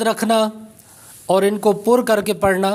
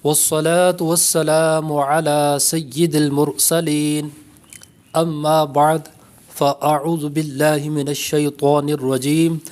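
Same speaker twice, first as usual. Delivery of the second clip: slow, measured Arabic recitation; drawn-out, level-toned formal invocation; 3 semitones lower, slower than usual. Real, not acted. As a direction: recite the opening praise slowly and evenly.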